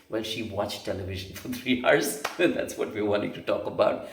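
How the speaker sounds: chuckling through the words; amused, laughing aside. Real, not acted. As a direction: laugh while telling it.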